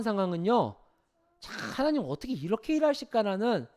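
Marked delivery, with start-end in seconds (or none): none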